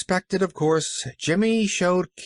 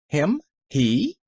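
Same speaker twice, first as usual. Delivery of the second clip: sharp, rising astonished questions; startled, demanding aunt; surprised; 6 semitones higher than usual. Acted.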